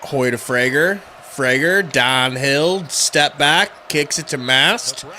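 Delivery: excited sports commentator, rising to shouts; play-by-play. Pretend. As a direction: call each pass, erupting on big plays. Mock play-by-play of game.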